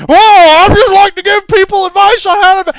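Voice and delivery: high, loud squeaky mock Cramer; mock Jim Cramer. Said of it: "Jim Cramer goes".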